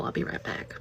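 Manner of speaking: breathy whisper, no voice; whispered aside. Real, not acted. Mother slipping off to check under the bed, whispered. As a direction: whisper it to the camera.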